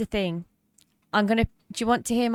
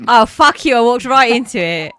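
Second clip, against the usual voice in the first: loud exasperated; 11 dB louder than usual. Real, not acted. Groaning after falling for joke.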